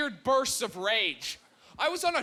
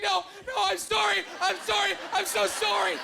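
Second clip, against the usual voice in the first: put-on 1 over-apologizing girl; 8 semitones higher than usual. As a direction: keep piling on sorries in a panic. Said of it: "She's like".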